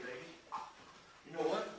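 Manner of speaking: ordinary talk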